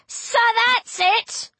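high, drawn-out challenging shout; defiant shout. A narrator's reading. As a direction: call them out, pitched high. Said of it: "I shouted at the cryosphinxes".

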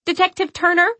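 high, loud shout for help. Acted. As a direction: call out urgently across the room. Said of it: "I shout".

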